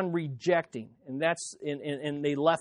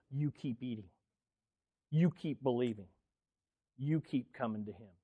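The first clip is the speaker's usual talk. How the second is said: quiet urging; 4 semitones lower, 7 dB quieter than usual. Real, not acted. Preacher drops to intimate exhortation.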